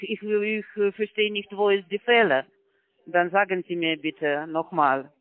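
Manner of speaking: normal talking voice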